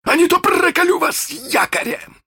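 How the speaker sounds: very high-pitched threatening yell; shouted pirate threat. Acted. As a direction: yell it as a captain threatening his crew. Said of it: "крикнул он".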